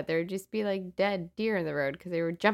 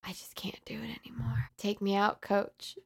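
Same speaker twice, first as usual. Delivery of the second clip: sad and defeated; 7 dB quieter than usual. Retold or imitated. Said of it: "so sad and defeated".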